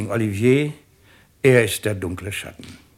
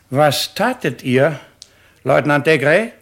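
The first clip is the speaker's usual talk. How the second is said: stern loud demand; 5 semitones higher, 8 dB louder than usual. Acted. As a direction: call him to account with force. President confronts the lieutenant.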